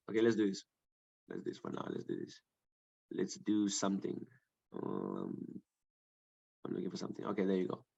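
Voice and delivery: very low, soft murmur; absent, barely voiced self-talk; muttering to oneself. Real, not acted. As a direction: think aloud under your breath while searching. Muttering to himself while searching the material.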